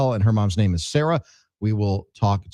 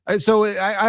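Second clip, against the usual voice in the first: usual speaking voice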